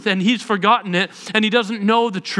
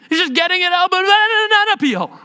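rising to a high pitch; 9 semitones higher than usual. Shown as a demonstration. Voicing rising rage.

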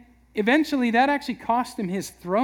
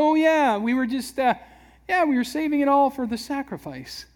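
higher, stammering fake innocence; sheepish excuse; 6 semitones higher than usual. Saul caught out, stammering an excuse to Samuel, sheepish. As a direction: cover up, caught red-handed.

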